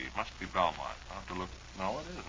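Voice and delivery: normal talking voice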